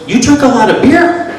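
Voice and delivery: put-on high Vietnamese accent, sharply rising question; exaggerated mock Vietnamese accent; imitated Vietnamese accent. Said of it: "have to excuse my accent".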